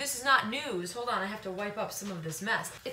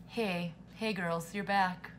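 softer, slow, tender; soft greeting; 5 semitones lower, 6 dB quieter, slower than usual. Real, not acted. Greeting her ghost girl gang, soft and slow. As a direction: coo gently to them. Talking softly to pets.

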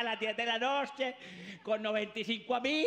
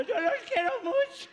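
put-on high, loud slurred drunk shouting; drunk weepy mother; 10 semitones higher, 4 dB louder, slower than usual. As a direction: wail love at the kids while drunk. Drunk mother crying to kids.

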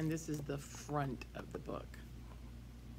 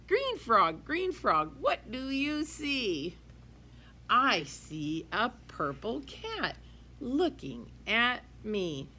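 put-on lively sing-song read-aloud for kids; reading to children; 7 semitones higher, 3 dB louder, slower than usual. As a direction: read it bright and bouncy to a class. Teacher reads picture book aloud.

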